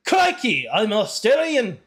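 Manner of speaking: broad Australian with a punched CRIKEY; Australian accent. Shown as a demonstration. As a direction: put on an exaggerated Aussie accent. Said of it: "an Australian voice".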